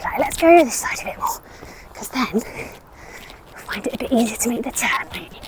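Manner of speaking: soft whisper to the horse. Real, not acted. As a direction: hush it gently to him. Coaching horse while riding.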